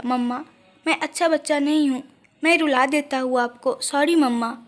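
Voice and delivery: ordinary delivery, nothing marked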